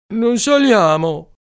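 high, loud, slow protest; hesitant kid. Acted. Bombolo balking at climbing, high, loud and slow. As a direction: drag out the doubt like a scared boy.

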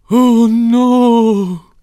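long, high, loud wail of dismay; dismayed cry. Acted. Family hearing of the eviction, dismayed cry. Family reacts to the bad news.

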